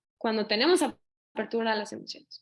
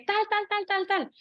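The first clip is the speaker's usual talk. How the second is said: high, rapid mock rant of filler words; 9 semitones higher than usual. Parent exploding at the line-cutter, mock rant. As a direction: sketch a furious rant with a mock tirade. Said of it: "exploté y le dije".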